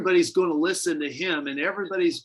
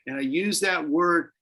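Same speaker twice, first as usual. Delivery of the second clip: ordinary delivery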